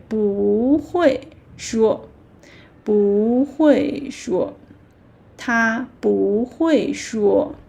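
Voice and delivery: slow model phrase, 不 rising before falling 会; natural rising tone. Shown as a demonstration. Teacher builds the sentence for learners, slowly, 不 rising. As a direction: stretch 不 upward, then drop 会. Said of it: "natural like native speaker".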